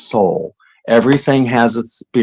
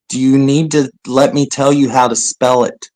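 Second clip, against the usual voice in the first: normal talking voice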